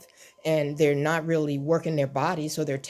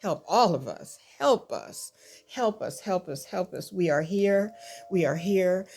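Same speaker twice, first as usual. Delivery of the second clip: drawn-out, pleading cry to the Lord; real, earnest plea in repeated bursts; 3 semitones higher than usual. Real, not acted. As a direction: cry out for help, pitch lifting on the pleas. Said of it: "I'm crying out and saying".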